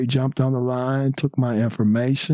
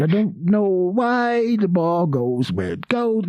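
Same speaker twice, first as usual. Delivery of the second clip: Cosby ramble; 6 semitones higher than usual. Retold or imitated. Keeps imitating Cosby rambling.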